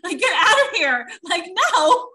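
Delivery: loud, slow pretend telling-off; angry telling-off. Wife turning away unwanted in-laws, loud mock anger. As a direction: snap back at her, loud and slow. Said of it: "I'd be like".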